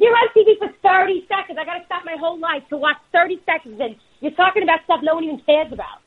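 the mom complaining she stops her life for thirty seconds; imitating his mom. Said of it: "She goes".

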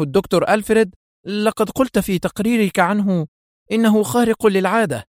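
high, startled disbelief; astonished. A narrator's reading. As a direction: gasp at the news. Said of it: "يقول بدهشة". (دهشة عالية من جوزيف أمام رئيسه)

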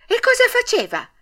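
put-on very high, loud child's question; excited high-pitched query; eager questioning. A narrator's reading. Puppet eager for news of father.

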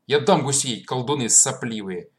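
loud, furious telling-off; angry scolding. Acted. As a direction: yell at the noisy kids. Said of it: "запретил им орать и самих разогнал".

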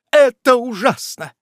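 high horrified woman's cry; exclaiming. A narrator's reading. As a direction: gasp it out in horror. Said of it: "воскликнула миссис Уэллер".

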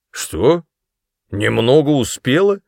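«Немного успела?» asked with a sharp rise on «успела»; incredulous. A narrator's reading. Father repeats in disbelief. (недоверчиво переспрашивая, с подъёмом на «успела»)

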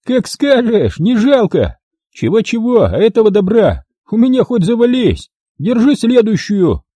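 high-pitched, jaunty character shout; cheerful reply. A narrator's reading. Gunman answers that he has ammo to spare, cheerful. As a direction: call it out cheerfully, pitched well up. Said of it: "Весело ответил он".

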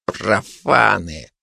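slow, low, contemptuous; through gritted teeth. Acted. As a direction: squeeze it out through clenched teeth. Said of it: "процедил он сквозь зубы". (процедить сквозь зубы)

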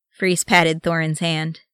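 plain reading voice

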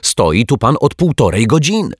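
loud, high exasperated outburst; irritated, forceful accusation; annoyed. A narrator's reading. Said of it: "zdenerwowała się pani sprzątaczka".

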